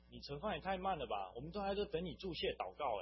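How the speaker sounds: loud, high-pitched mock complaint; impatient chiding. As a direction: imitate buddies nagging a slowpoke. Tablemates impatiently chide him, quoted.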